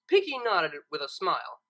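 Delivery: usual speaking voice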